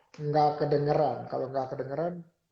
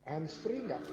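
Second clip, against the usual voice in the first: echoed phrase repeats; 3 semitones higher, 7 dB quieter, slower than usual. Real, not acted. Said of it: "Suara saya tuh menggema".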